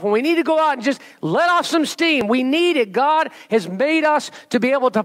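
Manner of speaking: high, ringing sermon voice, building fervour; preaching at full force. Acted. Pastor urging the church to have clean fun, fervent preaching.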